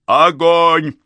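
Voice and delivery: sharp loud order to fire; shouted command. Acted.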